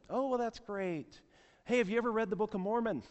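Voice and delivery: high-pitched, friendly missionary imitation; missionary's friendly pitch. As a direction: mimic a keen door-to-door evangelist, voice lifted.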